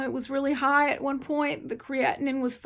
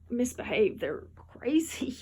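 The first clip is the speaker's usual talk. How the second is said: usual speaking voice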